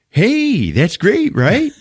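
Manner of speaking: high-pitched fake enthusiasm, laughing at it; hammed-up perky reassurance; mock cheeriness. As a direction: sell terrible news as great.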